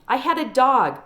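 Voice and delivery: plain reading voice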